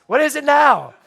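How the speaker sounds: loud, high imitation of an impatient kid; pestering child. Boy checking the tub every five minutes, mock impatient. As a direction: pipe up like a child who keeps asking.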